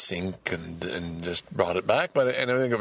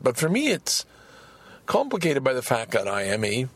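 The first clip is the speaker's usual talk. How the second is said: ordinary talk, nothing marked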